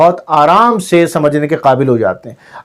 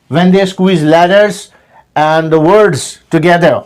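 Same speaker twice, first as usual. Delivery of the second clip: normal talking voice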